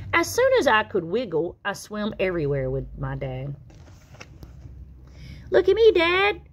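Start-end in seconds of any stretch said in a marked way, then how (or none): 5.5-6.4 put-on high, loud child's call; a little one calling to his dad for attention; excited little tadpole. Tad shows off to his dad, high and loud.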